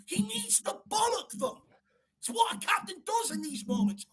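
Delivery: high-pitched, strained outburst; exasperated rant. Real, not acted. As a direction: protest loudly in exasperation. Exasperated rant about the captain.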